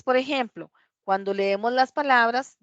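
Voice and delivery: usual speaking voice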